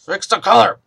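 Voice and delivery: "Fix the color" said loud and clear. Real, not acted. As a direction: call out "Fix the color" firmly. Muttered then repeated aloud.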